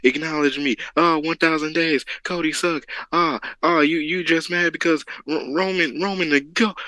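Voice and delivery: lightly ape a rowdy Roman fan in the crowd; half-hearted mock fan heckling. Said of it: "Got Roman fans all over the place".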